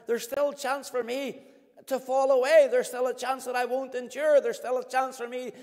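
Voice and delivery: loud, driving sermon delivery, hammering each point; full-force preaching. Acted. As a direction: preach it out to the whole congregation.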